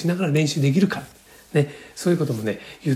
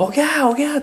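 high-pitched TV baby cry, mimicked; imitated newborn wail; 11 semitones higher, faster than usual. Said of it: "ああいう感じじゃないですよ". (テレビでよくある赤ちゃんの産声の真似)

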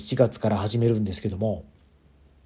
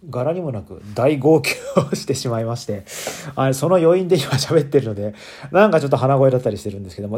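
stuffy nose after crying; nasal voice; 6 dB louder than usual. Real, not acted. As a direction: speak through a blocked, teary nose.

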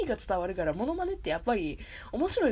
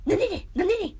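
higher, louder questioning put-on voice; Okiai Ryotaro impression; 10 semitones higher, 6 dB louder, faster than usual. Host copying Hiroki's Okiai Ryotaro impression, high and loud. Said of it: "沖合良太郎さんのモノマネ".

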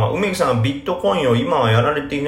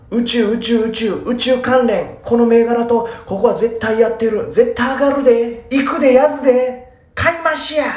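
much higher and louder, pushy Kansai hype building up; hyped Kansai trader; 13 semitones higher, 5 dB louder than usual. Retold or imitated. Podcaster imitates a hyped Kansai board poster, high and loud. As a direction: rave like a cocky Osaka stock tout. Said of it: "の方がなんか勢いあるでしょ".

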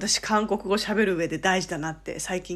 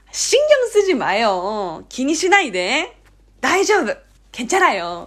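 higher, insistent, stretched endings; emphatic reassurance; 3 semitones higher, 4 dB louder than usual. Shown as a demonstration. Korean who dislikes fuss, insistently reassuring. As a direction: press each reassurance firmly. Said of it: "強めに念を押すように".

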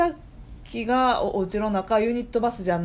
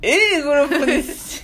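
louder, higher, breaking into laughter on "Aグループにし-"; laughing; 8 semitones higher, 5 dB louder than usual. Real, not acted. Cohost bursts out laughing at the name mid-sentence. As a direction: crack up mid-word.

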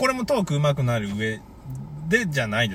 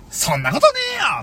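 shouted retort; 13 semitones higher than usual. Pretend. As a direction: yell it back in protest. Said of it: "って言ってほしいよ".

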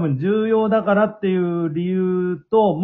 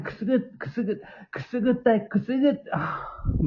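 breathy tickled gasps, rising; softly squirming at a tickle; being tickled; 6 semitones higher, 6 dB quieter than usual. Retold or imitated. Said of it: "みたいな".

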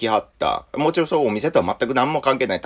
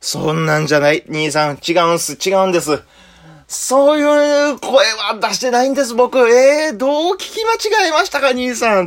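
much higher, louder pleading protest; flustered denial; 12 semitones higher, 4 dB louder than usual. Retold or imitated. A junior frantically denying it to his senior, high and loud. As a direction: panic and deny it in a rush.

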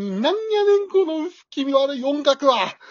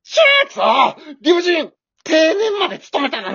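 outraged shout; 5 dB louder than usual. Acted. Husband raging at the haunted house, shouting. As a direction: yell at the unfairness.

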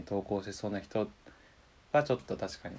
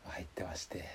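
whispering; 4 semitones lower, 15 dB quieter than usual. Real, not acted. Whispered aside boast.

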